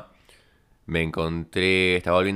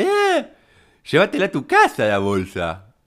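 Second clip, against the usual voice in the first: very high, loud screeching yell; hoarse toy poodle; 9 semitones higher, 6 dB louder than usual. Retold or imitated. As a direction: yap it shrilly, imitating an angry woman in the street. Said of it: "el tono de voz de un caniche toy afónico". (caniche toy afónico gritando)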